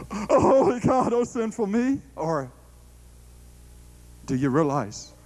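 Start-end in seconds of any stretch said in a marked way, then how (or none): 0.1-2.0 fearful cry. Retold or imitated. Preacher mimicking a shamed believer, crying out in mock fear. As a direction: wail it as a terrified sinner. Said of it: "what is your image of God".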